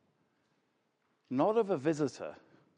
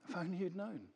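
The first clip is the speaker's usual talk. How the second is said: soft lament; 13 dB quieter, much faster than usual. Acted. Echoing Jesus' lament over Jerusalem.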